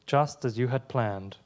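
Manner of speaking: measured, deliberate poem reading with pauses between lines; steady poetry-reading voice. Acted. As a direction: read each line as a poem, letting it land. Reads own poem aloud.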